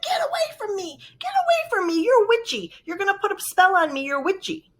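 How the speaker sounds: high-pitched mock panicked rebuff; alarmed stranger. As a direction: imitate a woman frantically warding off a witch. Acting out hostile stranger's rebuff.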